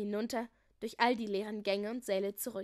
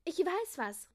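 put-on higher-pitched girl's call; eager call; 7 semitones higher, slower than usual. A narrator's reading. Julia offering a punishment idea, high eager call. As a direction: pipe up with an idea. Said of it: "rief Julia".

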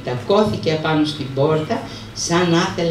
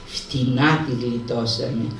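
lower, softer and slow, spent after the run; out of breath; 6 semitones lower, 5 dB quieter, slower than usual. Acted. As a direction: gasp it out, exhausted. Arapis exhausted after running. (λαχανιασμένα, εξαντλημένος)

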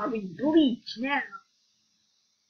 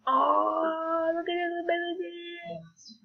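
amazed; 7 semitones higher, slower than usual. Real, not acted. Vlogger marveling at a character's powers, awed. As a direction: gasp out the wonder.

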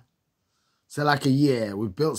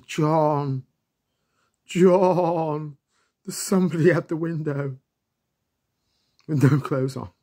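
raised, higher call of John through the window; 5 semitones higher, 5 dB louder than usual. Retold or imitated. Kids calling John about someone at the window, raised call. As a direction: call his name, half spooked.

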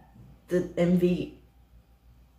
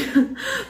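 high, rising embarrassed giggle. Real, not acted. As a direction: laugh at yourself after the retake. Laughing after retaking sign-off.

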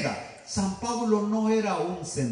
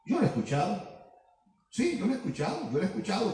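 hushed confiding; 7 dB quieter, much faster than usual. Real, not acted.

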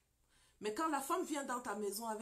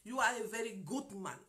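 broken English; 5 dB louder than usual. Retold or imitated. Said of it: "avec mon anglais bien déformé".

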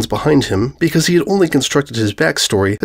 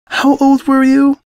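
prim mare; 4 dB louder, slower than usual. A narrator's reading. Mare feigns polite interest.

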